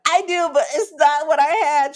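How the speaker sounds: high, loud plaintive protest; a tearful whine of complaint; whiny protest. Retold or imitated. Pleading with her girlfriend, high whiny protest. Retold plea to friend.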